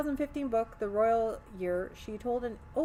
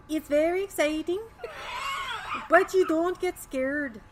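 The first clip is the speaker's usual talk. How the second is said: high, loud hammed-up Swedish accent; 8 semitones higher, 6 dB louder than usual. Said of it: "should I do the Swedish accent?".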